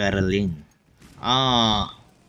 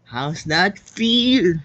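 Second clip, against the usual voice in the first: slow, louder mocking taunt; 6 semitones higher, 4 dB louder, much slower than usual. Real, not acted. Gamer gloating at the beaten Reich, slow mocking taunt. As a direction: rub it in slowly.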